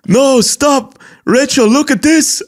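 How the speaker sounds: high, insistent put-on voice; mock pleading turning into showing off. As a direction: plead high as the caricatured streamer. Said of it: "at one point he goes".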